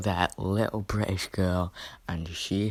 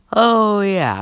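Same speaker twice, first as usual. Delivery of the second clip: sudden recollection; 12 semitones higher, 14 dB louder than usual. Real, not acted. Suddenly remembering a missed point.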